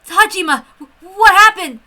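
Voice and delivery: high, loud frightened shout; stammering, startled yell; alarmed call. Acted. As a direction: call out in panic to a friend. Calling out to fleeing colleague.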